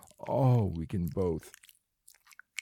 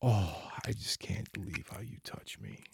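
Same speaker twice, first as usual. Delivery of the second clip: breathless unvoiced whisper; 4 dB quieter than usual. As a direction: whisper it in disbelief. Erotic roleplay whisper.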